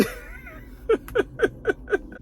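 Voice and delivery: breathless squeaky laugh; a friend cracking up at the drama; high wheezing laugh. Real, not acted. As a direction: cackle high and airless. Laughing at the scene.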